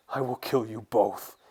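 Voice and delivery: understated snarling threat; a touch of rage, firmly stressed; menacing threat. Acted. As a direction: threaten them in a villain's voice. Enraged skinwalker threatens the pair.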